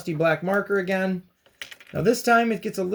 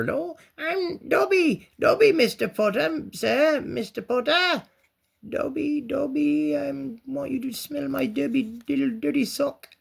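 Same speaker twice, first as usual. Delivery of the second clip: high, squeaky house-elf voice, much higher than usual; Dobby impression; 10 semitones higher than usual. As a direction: pitch way up and plead like a nervous house-elf. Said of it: "A little dobby from old Harry Potter".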